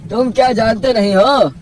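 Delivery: high, projected, incredulous uncle; exasperated village kaka voice; scolding village elder. Acted. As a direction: set a foolish neighbour straight.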